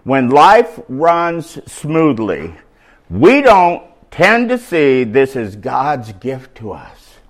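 slow, deliberate reading, every word weighed; slow emphatic reread. Shown as a demonstration. Preacher rereads the key line slowly for the congregation. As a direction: read it slowly so they hear it. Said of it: "you gotta hear this part".

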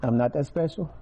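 ordinary talk

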